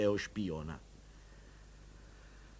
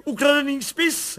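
higher, louder joyful telling; excited clamour; 12 semitones higher, 9 dB louder than usual. A narrator's reading. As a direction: tell her the news in delight. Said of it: "hlavolil plukovník".

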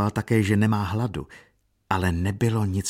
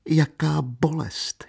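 much higher, slow acted wail of pain; sobbed lament rising; 8 semitones higher, slower than usual. A narrator's reading. Katerina alone weeping convulsively, higher slow sob. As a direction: repeat it higher, grief swelling. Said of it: "štkala".

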